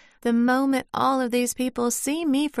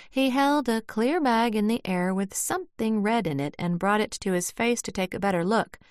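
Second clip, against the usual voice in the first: usual speaking voice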